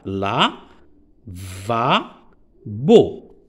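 "La, va, bo" with a clear pause after each syllable. Shown as a demonstration. Teacher dividing lavabo for pupils, each of "La, va, bo" standing alone. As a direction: count out "La, va, bo" as three separate pieces. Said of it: "on a trois syllabes".

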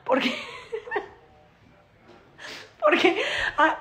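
high, giggly storytelling; laughing. Real, not acted. Telling fans about Javier fleeing the frog, laughing. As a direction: laugh as you tell it.

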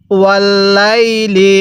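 slow ওয়াল্লাইলি with held l and long লাই; read-along recitation. Shown as a demonstration. Teacher has learners repeat after them, slow drawn-out ওয়াল্লাইলি. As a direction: stretch the middle of ওয়াল্লাইলি evenly. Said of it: "আরেকবার পড়ুন".

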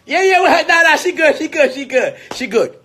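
high-pitched, loud brush-off that eases down; hurried reassurance. Acted. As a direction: wave everyone off, she's fine. Waving off concern after the quiet scolding.

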